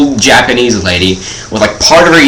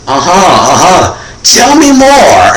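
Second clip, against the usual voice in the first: slow, much higher mocking encouragement; mock interested drawl; 5 semitones higher, slower than usual. Waitress teases the kid ordering a Coke, slow and mock-interested. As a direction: draw it out like fake fascination.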